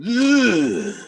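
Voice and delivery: effortful lifting grunt; strained grunt. Pretend. Grunt while mock lifting rocks for the camera. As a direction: grunt like hoisting a heavy log.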